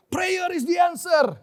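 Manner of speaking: loud, high, drawn-out proclamation; forceful declaration. Retold or imitated. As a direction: declare it hard, like quoting a fiery preacher. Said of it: "declare ini dengan kuat". (deklarasi yang kuat dan lantang)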